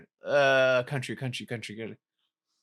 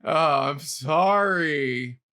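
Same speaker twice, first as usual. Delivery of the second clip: low, very slow, drawn-out sorry; sympathetic apology; 6 semitones lower, much slower than usual. Real, not acted. Host consoles losing friend, slow low sympathy. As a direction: draw it out soothingly.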